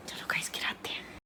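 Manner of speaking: hushed go-ahead; whispering to viewers before the spill. Real, not acted. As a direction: whisper it like a secret signal.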